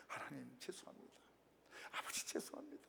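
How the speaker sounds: tearful prayer. Retold or imitated. To God outside the shop, a hushed tearful apology. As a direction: breathe the apology out softly. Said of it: "울면서".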